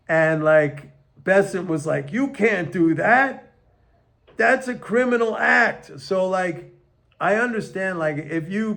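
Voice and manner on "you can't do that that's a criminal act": higher-pitched mock outrage; pitched-up, scolding imitation; mock objection. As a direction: imitate an official's indignant protest. Acting out Bessent's objection to Newsom.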